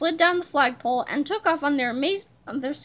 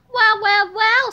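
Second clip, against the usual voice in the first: high, loud, knowing greeting; strange villain voice; 6 semitones higher, 6 dB louder, slower than usual. A narrator's reading. Strange voice greeting intruder, villainous and high. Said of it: "said a strange voice".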